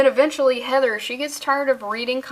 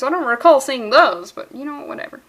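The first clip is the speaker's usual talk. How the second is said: usual speaking voice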